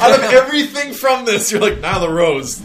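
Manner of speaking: high, loud laughing incredulity; amused disbelief. Real, not acted. Laughing disbelief at co-host's pick.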